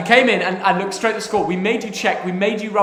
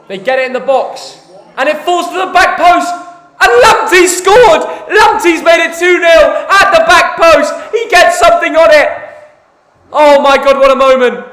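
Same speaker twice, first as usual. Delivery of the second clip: commentary building to a higher, louder goal shout; fake goal celebration; 7 semitones higher, 10 dB louder than usual. Son pranking Dad with a fake goal call, building excited shout. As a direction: sell the fake goal, rising to a roar.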